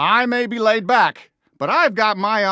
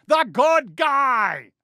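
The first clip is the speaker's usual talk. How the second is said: slow, shouted repeat, leaning on each word; stretched-out sheriff holler; emphatic repeat; 4 semitones higher, slower than usual. Acted. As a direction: insist even harder. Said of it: "I'm the good guy!".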